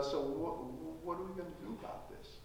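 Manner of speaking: hushed, fast, barely voiced question; Jesus leaning over, whispering about the problem; near whisper. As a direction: breathe it close to their ear. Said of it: "Jesus leans over and says".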